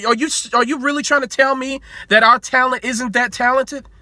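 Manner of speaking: ordinary talk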